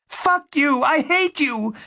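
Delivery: very high-pitched furious shriek. A narrator's reading. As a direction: shriek it with hatred.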